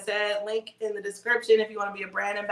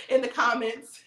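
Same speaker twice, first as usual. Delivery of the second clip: high laugh through the words; 5 semitones higher, 4 dB louder than usual. Real, not acted. Admitting drunken comments to viewers, laughing. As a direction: giggle the words out.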